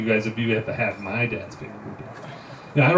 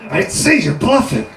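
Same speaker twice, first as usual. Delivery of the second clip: defiant prisoner; 9 semitones higher, 4 dB louder than usual. Acted. Prisoner calling bluff.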